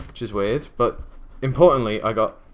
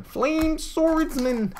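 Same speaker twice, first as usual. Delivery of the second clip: much higher, long drawn-out silly voice; hammed-up card-name call; much slower than usual. Card opener hamming up Flame Swordsman, drawn-out high silly voice. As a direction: stretch it out high and exaggerated, comically overdone. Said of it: "I'm just getting worse".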